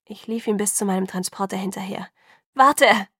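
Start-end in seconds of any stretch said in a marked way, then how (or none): 2.5-3.1 loud call after him; urgent loud shout. A narrator's reading. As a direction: shout it so he stops. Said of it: "rief ich".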